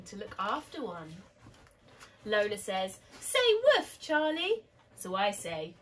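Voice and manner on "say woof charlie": put-on much higher, louder little-girl order; bossy little sister. A narrator's reading. Lola orders her brother to play dog, bossy and high-pitched. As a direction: squeal it as a playful command. Child bossing brother in story.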